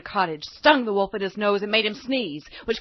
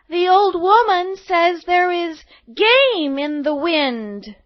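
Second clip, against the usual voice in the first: put-on high, slow, louder girl passing a message; little girl message; 9 semitones higher, 8 dB louder, slower than usual. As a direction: announce it carefully.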